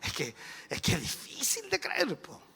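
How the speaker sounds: quiet mock protest; mock doubter. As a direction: imitate a hesitant sceptic, small voice. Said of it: "pero usted dice".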